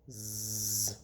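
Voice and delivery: soft snoring; sleeping girl snores softly. A narrator's reading. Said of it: "Amy snored".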